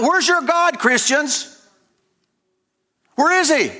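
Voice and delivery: high, jeering taunt, pitched well up and repeated; mocking taunt. Killers jeering at slaughtered Christians, high mocking taunt. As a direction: sneer it at the victims like a mob. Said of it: "the world is crying out".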